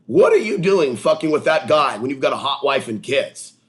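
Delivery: loud, high interrogation; accusing confrontation. Pretend. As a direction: challenge them with rising indignation. Confronting an imagined viewer.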